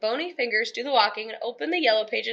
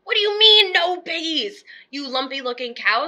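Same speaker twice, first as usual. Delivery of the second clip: scream; 4 semitones higher than usual. Acted. Witch screaming at a cow, furious. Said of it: "screamed Gritch".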